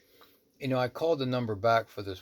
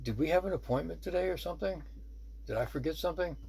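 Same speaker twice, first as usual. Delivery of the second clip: higher-pitched, puzzled polite question; 8 semitones higher, faster than usual. Retold or imitated. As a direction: ask it high, as if caught off guard. Puzzled by an unexpected visitor.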